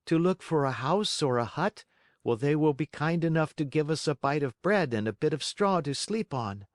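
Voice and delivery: lightly voiced eager boy; a little son full of hope; hopeful puppet boy. Pinocchio answers father.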